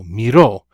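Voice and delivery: loud, falling stress on the end of "Miró"; stress on final O. Shown as a demonstration. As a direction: punch the O of "Miró". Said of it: "should have a written accent on the O".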